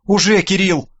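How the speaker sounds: usual speaking voice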